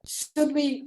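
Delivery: ordinary talk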